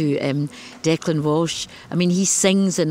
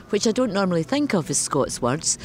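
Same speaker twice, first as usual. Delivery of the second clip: ordinary talk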